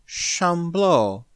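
somber. A narrator's reading. Alien girl names her kind to Smith, somberly. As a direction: let the name hang, grave and falling. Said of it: "She said it somberly".

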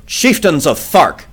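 loud, slow call to the council; cried out. A narrator's reading. John Carter calls to the council, crying out slowly. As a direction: call out to the assembled chiefs. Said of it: "I cried".

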